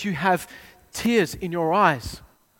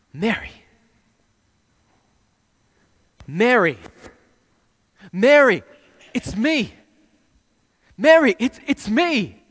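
calling her name, rising higher and louder; 5 semitones higher, 8 dB louder than usual. Acted. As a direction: call to her so she finally recognises you. Jesus calling out Mary's name.

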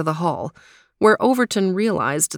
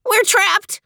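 much higher, louder panicked shriek; 5 dB louder than usual. Acted. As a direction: shriek it in terror. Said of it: "shrieked Theo".

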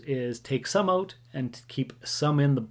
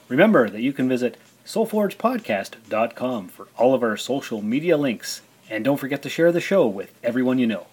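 louder, upbeat podcast outro read; announcer read; 5 dB louder than usual. Acted. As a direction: read it like a show sign-off.

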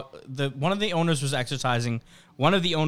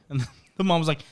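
ordinary talk, nothing marked

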